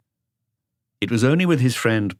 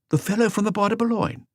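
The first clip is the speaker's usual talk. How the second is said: higher, lighter voice for the colleague; second officer's voice; voiced colleague. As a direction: lift into the other officer's voice.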